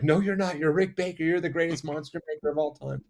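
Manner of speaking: high-pitched fanboy protest, acted out; starstruck gushing. Teenager retold gushing to his makeup idol, high starstruck protest. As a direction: gush like an overwhelmed young fan.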